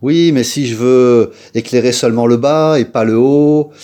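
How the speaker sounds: imitation traînante d'un râleur; objection moqueuse imitée. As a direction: singe le spectateur qui chipote. Imite les spectateurs qui râlent. (objection geignarde, pour se moquer)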